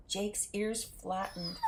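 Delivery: ordinary delivery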